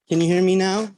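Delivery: ordinary talk, nothing marked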